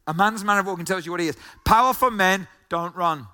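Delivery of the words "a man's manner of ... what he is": fast rattled repeat. Shown as a demonstration. Preacher repeating the quote, racing through it fast. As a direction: rush the quote through at speed.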